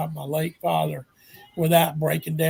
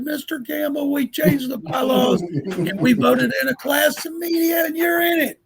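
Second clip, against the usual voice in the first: high-pitched, strained nervous outburst; voice gone real high; 10 semitones higher, 3 dB louder than usual. Retold or imitated. Confessing the bylaw change to Mister Campbell, voice shooting up high. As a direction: blurt it out in a much higher voice. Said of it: "my voice got real high".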